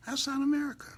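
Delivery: high indignant cry. Real, not acted. Repeated much higher.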